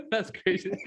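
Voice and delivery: amused, laughing through the words. Real, not acted. Listener reacting to a funny family story, laughing. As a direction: burst out laughing, then get it out.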